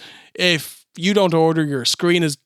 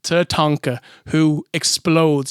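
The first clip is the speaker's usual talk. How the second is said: usual speaking voice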